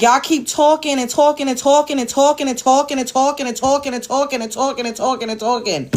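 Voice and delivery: "talking" repeated over and over in a relentless chain; fed-up, droning repetition; irritated. Real, not acted. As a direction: mimic endless chatter in exasperation. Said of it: "it's so fucking irritating".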